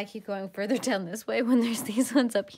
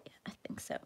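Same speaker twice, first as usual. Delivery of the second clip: soft ASMR whisper; 12 dB quieter, much faster than usual. Real, not acted. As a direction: whisper it close to the mic.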